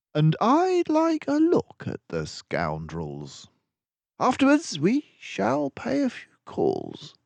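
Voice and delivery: a clever sleuth delighted with his friend; Basil the detective. A narrator's reading. Basil praising Dawson's deduction, brisk and pleased.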